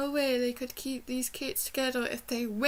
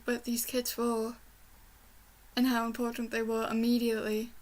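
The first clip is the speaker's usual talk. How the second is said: usual speaking voice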